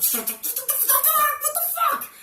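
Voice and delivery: very high, strangled mock panic; squeaky, drawn-out outburst of embarrassment; mortified squeal. Son reacts to mom telling the doctor, mortified squeal.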